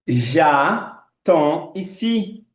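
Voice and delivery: very slow, stretched French; very slow demo. Shown as a demonstration. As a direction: stretch every syllable for learners. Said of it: "Eu vou pronunciar". (francês esticado, bem lento)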